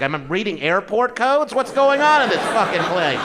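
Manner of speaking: loud, high, incredulous; baffled outburst. Retold or imitated. Comic reading a dating app, loud and baffled. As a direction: shout it like the app makes no sense.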